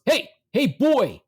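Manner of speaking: high, loud imitated holler; yelling dad. Dad in the audience to son on stage, yelling. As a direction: imitate a father hollering for his son's attention. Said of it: "Finally, he yells".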